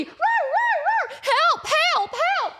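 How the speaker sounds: very high dog-like yelps; hammed-up squealing barks and cries; imitated barking. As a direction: bark out a mock rescue alarm. Acts out Lassie and panic.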